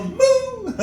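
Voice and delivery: very high laughing moo; happy cow. Retold or imitated. Comic returning to the happy cow's moo, very high. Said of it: "But they're happy".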